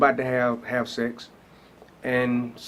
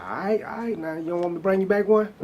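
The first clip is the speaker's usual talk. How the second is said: high, quick, playful ribbing; teasing; 8 semitones higher than usual. Retold or imitated. Ribbing cousin Charles who mocked his church trip, playful and quick. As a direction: needle him fast and high, grinning.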